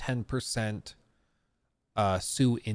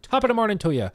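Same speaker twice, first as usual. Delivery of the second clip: put-on playful stage Irish accent, pitched higher; mock Irish accent; 10 semitones higher, 6 dB louder, faster than usual. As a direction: toss it off in a cartoon Irish accent. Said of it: "Is that offensive for Irish people?".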